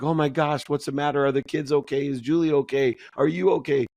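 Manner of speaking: high, alarmed mother's voice; voicing a frantic mom at the door; worried mom. Son retells mom panicking at the door, high alarmed voice. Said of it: "my mom's like".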